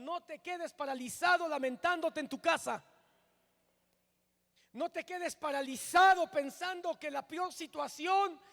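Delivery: loud, high fervent preaching; preacher rallying a struggling congregation, loud and fervent; preaching at full force. Acted. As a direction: proclaim it like a revival preacher, loud and driving.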